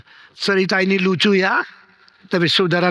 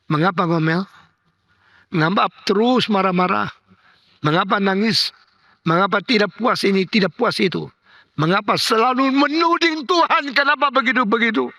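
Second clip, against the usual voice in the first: rising, insistent preaching questions, climbing higher; preaching rebuke; 6 semitones higher than usual. Acted. Preacher scolds grumbling congregation, rising, insistent. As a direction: rebuke the grumbling flock, building.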